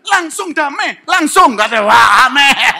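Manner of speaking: high, loud, slow cry of relief; relieved excited friend. Retold or imitated. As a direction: burst out with relief and joy. Retelling friend's relieved exclamation.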